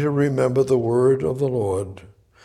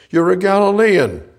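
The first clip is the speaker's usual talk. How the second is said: accusing shout; 6 semitones higher, 6 dB louder than usual. Acted. Bystanders accuse Peter.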